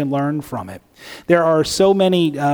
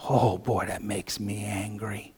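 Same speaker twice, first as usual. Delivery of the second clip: quiet bitterness; 6 semitones lower, 10 dB quieter than usual. Pretend. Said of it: "That's how I'd feel".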